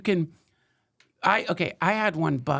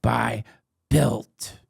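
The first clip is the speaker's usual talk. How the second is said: ad read; 3 semitones lower than usual. Acted. Reads a sponsor ad.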